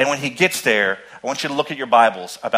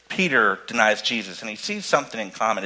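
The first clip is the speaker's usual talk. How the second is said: ordinary delivery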